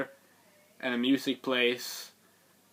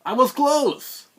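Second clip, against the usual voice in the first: much higher and louder, slow yell; mock frustrated yell; 13 semitones higher, 9 dB louder, slower than usual. Narrator reliving his wrong guess, slow loud yell. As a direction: shout out the near miss. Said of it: "So I'm like".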